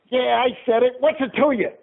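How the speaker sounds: high-pitched cocky comeback; mock defiant. Dummy talks back to an angry husband, mock defiant. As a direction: challenge him, playing the brazen dummy.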